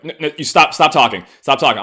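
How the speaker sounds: loud, high stop-talking plea; mock alarmed shout of friends; panicked friends. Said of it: "her friends are like".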